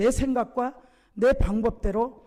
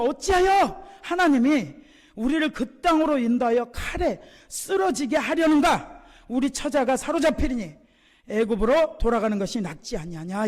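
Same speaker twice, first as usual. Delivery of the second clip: resentful protest; 3 dB louder than usual. Retold or imitated. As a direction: imitate a crowd blaming its leaders. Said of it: "모세와 아론을 막 원망을 해".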